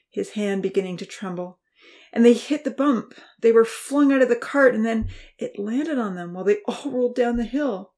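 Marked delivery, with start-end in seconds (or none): 2.1-7.9 higher, louder rush; frantic account. A narrator's reading. Uncle describing the crash, frantic. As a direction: tell it in a rush.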